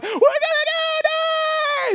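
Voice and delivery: high, loud panicked shout; panicked yell. Retold or imitated. Boy fleeing the killer dog yells in panic. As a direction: scream it like you're about to die.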